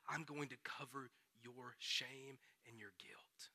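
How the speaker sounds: tender whispered promise; hushed whisper. Acted. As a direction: breathe God's promise in a whisper. God's promise, dropped to hush.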